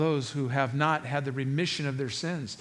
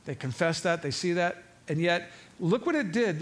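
ordinary delivery

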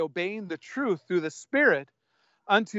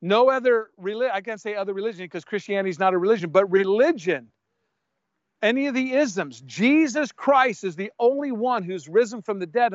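ordinary talk, nothing marked

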